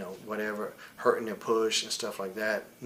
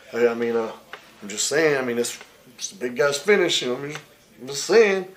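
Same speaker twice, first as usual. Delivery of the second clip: mocking imitation; 6 dB louder than usual. Mocking Sean's fake-casual warning to the boys, loud and sneering. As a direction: sneer it back as a fake-nice excuse.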